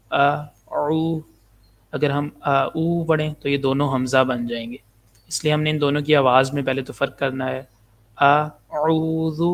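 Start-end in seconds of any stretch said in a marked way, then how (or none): 0.1-1.2 ا light, then او thick from the throat, slowly; hamza then ain. Shown as a demonstration. Teacher repeating the hamza-ain contrast, slow. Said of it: "عین کی آواز گلے سے موٹی آواز". 8.2-9.4 اعوذ slowly with a throaty ain and soft final dal. Shown as a demonstration. Teacher showing how to end اعوذ, soft dal. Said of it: "دال کو نرمی سے پڑھنا ہے".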